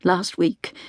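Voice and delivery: ordinary delivery, nothing marked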